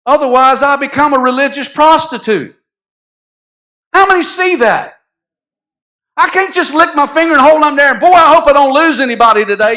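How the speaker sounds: high, loud, driving preacher's climax; preaching at full force. Acted. Pastor pressing the congregation to hear the truth, preaching loud and high. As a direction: preach it hard, pushing the congregation.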